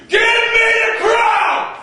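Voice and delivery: tyrant's roar. Acted. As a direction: bellow for the crown. Tyrant demands crown.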